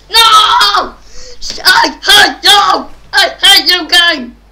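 loud, high, slow angry yell; real rage shout; furious shouting at the game. Real, not acted. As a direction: scream your hatred at the game.

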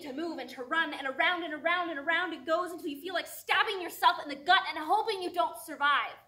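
loud, high, relentless build; screaming anxiety. Acted. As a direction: shout it in a spiralling rush of desperation. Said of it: "anxiety is screaming".

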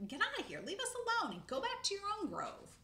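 high, loud angry shouts; high loud angry mob yell. Acted. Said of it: "The shouts turned".

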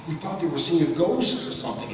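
ordinary talk

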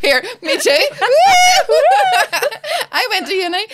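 cracking up while talking; laughing. Real, not acted. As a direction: gasp it out between real laughs. Laughing over murder joke.

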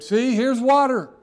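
loud, high excited cry; eager eunuch. Retold or imitated. Eunuch eagerly pointing out water to Philip, loud and high. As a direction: burst out like a man seeing water. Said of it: "that eunuch said".

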